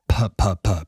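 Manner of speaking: punchy popping puh sounds into the mic; plosive puh demo. Shown as a demonstration. Showing mic pops to viewers, punchy puh sounds. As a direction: pop the P hard, three times. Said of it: "but if I go".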